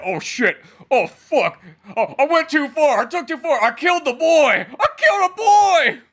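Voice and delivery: hammed-up horror building to a shout; mock panic. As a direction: start shaken, climb to loud, high wailing panic. Acting Bruno panicking over the killing.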